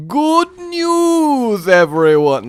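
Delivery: high, loud, long-held "news"; theatrical character voice; put-on character catchphrase. Shown as a demonstration. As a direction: croon a slow, drawn-out announcement to all. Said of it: "I can do a better one than that".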